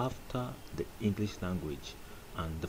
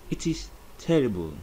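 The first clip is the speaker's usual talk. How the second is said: ordinary talk, nothing marked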